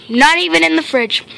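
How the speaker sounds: usual speaking voice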